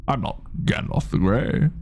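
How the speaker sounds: soft, unvoiced wizard line; whispered Gandalf. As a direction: breathe it like an old wizard.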